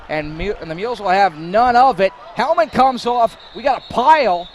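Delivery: high and loud, rising alarm; excited play-by-play. Real, not acted. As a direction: call the scrum with rising urgency. Helmet comes off pile.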